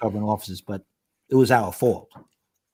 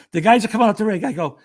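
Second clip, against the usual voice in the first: ordinary talk, nothing marked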